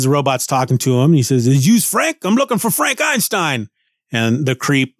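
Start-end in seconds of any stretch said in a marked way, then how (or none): 1.5-3.7 high, gruff Brooklyn tough-guy robot; a tiny robot doing a New York bruiser; Ben Grimm. Acted. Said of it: "associate that with Ben Grimm".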